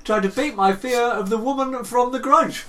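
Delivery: high, loud, theatrical confession; mock-heroic declaration. Explaining a fall downstairs to whoever found him, mock-heroic. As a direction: proclaim it like a grand mission.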